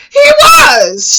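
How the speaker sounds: high, loud insistence; emphatic exclamation. Real, not acted. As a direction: burst out in amused agreement. Exclaims that he really was short.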